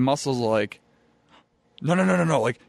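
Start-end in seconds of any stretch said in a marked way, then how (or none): 1.8-2.4 higher-pitched, rapid-fire refusal; retold objection. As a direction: imitate a teammate objecting. Retold objection before walking out.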